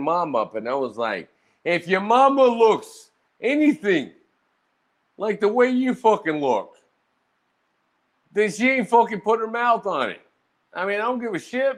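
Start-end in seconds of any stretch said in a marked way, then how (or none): 1.6-11.8 high-pitched, indignant put-down; retold mama roast. Roaster answering a man about his mama, high-pitched scorn.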